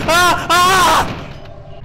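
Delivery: two high, loud shrieks; startled scream. Real, not acted. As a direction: scream in sudden fright. Jump scare.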